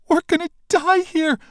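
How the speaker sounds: very high, loud terror; panicked cry. Acted. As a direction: wail it out. Panicking as monster attacks.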